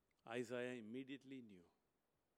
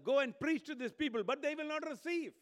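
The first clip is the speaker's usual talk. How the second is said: much higher, loud commanding voice; God's command; 13 semitones higher, 12 dB louder than usual. Acted. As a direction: boom it out as God sending a prophet. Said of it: "God tells him".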